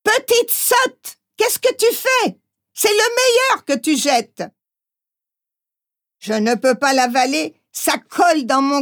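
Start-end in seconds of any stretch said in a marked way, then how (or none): 0.0-4.4 high, scolding cry of a farm wife; farmer's wife exclaiming. A narrator's reading. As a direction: cry out at the silly girl, pitched way up. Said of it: "La fermière s'écrie".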